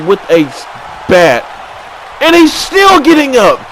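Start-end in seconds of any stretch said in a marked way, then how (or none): 2.2-3.6 excited shout. Real, not acted. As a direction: yell over the chaos. Wild steel-stairs spot.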